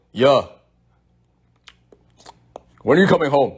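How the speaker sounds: imitating dad. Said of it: "answers his phone like this".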